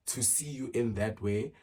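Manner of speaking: usual speaking voice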